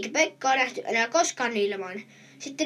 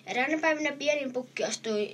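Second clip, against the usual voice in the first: plain reading voice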